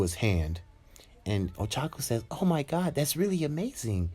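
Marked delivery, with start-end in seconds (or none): 2.3-4.1 high-pitched acted wonder; amazed. Girl watching him pull a rock, high amazement. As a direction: exclaim it high, impressed by the feat.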